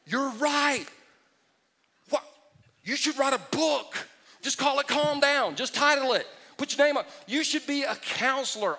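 hammed-up fake amazement; sarcastic praise. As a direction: gush over them sarcastically. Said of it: "And I went".

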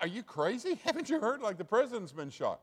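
high, laughing disbelief; a short laugh, then amazed he hasn't heard; incredulous trucker. Acted. Truck driver to the stranger, high and laughing in disbelief.

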